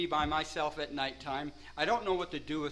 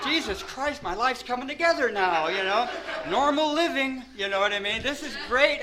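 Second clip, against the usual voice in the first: giddy excitement; 5 semitones higher, 5 dB louder than usual. Retold or imitated. Thrilled about his new life.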